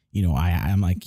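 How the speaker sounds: normal talking voice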